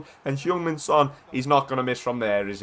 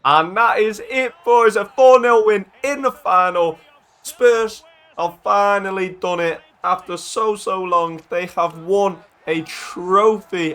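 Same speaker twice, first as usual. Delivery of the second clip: high, loud, drawn-out victory commentary; triumphant final call; 5 semitones higher, 4 dB louder, slower than usual. Real, not acted. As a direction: proclaim the win slowly, hammering each phrase. Final whistle, trophy won at last.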